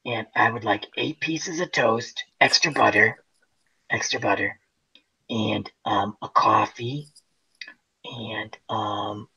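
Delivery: 1 ladylike voice. Retold or imitated. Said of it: "really nice, ladylike voice".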